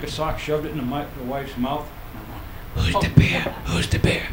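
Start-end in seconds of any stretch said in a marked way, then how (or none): 2.7-4.2 hushed whisper in bed. Retold or imitated. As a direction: whisper it so the kids won't hear. Whispering so kids won't hear.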